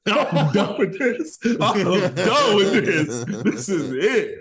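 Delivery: high, slow, laughing through the words; laughing quit. Retold or imitated. Player laughing as he retells quitting after a hard hit. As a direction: laugh as you give up. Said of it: "and I said".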